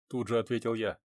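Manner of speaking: ordinary delivery, nothing marked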